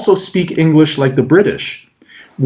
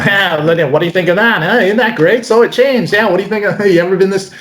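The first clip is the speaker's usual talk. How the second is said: higher, rambling dorky patter with a laugh; goofy, throwaway close-up patter; dweeby magician; 4 semitones higher, faster than usual. Acted. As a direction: babble like a nerdy magician underselling the trick. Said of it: "were underselling the magic".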